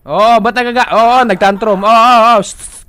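alarmed excited. Real, not acted. Player facing raging creatures, frantic loud calming. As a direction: urgently try to calm them down.

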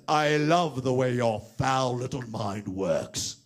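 slow, lightly voiced villain; a hint of a sly villain; imitating Jafar. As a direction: son quoting the villain's line back to the puppet. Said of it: "That's Jafar".

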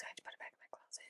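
fast, breathy whispered confession; tiny whispered aside; ashamed whisper. Shown as a demonstration. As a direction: mutter it fast like a guilty secret. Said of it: "And I put it back in my closet".

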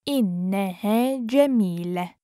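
measured, careful teaching pace. Shown as a demonstration. Teacher repeats phrase slowly joined.

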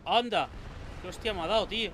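loud startled outburst; surprised shout. Real, not acted. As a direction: yell in shock at the hit. Boss lands a big hit.

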